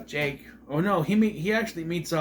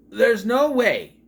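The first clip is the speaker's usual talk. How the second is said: high, loud, slow protest; stretched, incredulous objection; emphatic disbelief; 8 semitones higher, 8 dB louder, slower than usual. Real, not acted. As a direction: belt it out in disbelief. Disputing the film's timeline.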